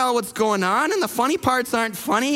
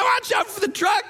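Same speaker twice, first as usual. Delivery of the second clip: sudden high mock shout; alarmed warning; 10 semitones higher than usual. Passenger warning the driver about a truck, alarmed shout. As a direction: yelp it out mid-sentence.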